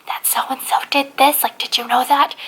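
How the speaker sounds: airy hushed imitation of a gossiping whisper; breathy secretive murmur, imitating; mock whisper. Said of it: "they whisper about it".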